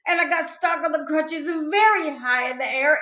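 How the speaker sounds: usual speaking voice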